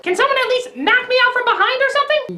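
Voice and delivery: high-pitched put-on woman's voice; indignant heroine. Lucy objects to her captor, in high indignant mock voice.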